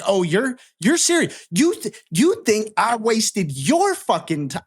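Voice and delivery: high and slowing, as if the words can't be real; stunned disbelief. Retold or imitated. To the dealer who called him disrespectful, retold, high outraged disbelief.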